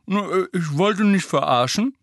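mumbled through a mouthful of food; mouth full. Acted. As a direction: talk round a full mouth. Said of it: "Rons Mund schon wieder gestopft voll".

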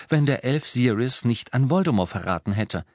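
ordinary talk, nothing marked

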